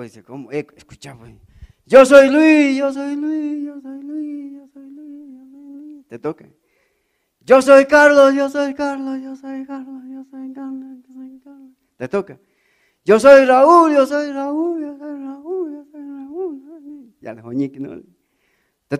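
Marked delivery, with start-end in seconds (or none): 1.8-6.1 a mock echo fading out. Imitating echo in a canyon. 7.4-11.7 a mock echo fading out. Imitating echo in a canyon. 13.0-17.1 a mock echo fading out. Imitating echo in a canyon.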